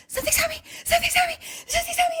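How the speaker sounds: high-pitched, loud panicked squeal; mock panic. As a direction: squeal it in exaggerated terror. Retold panic at spider on her.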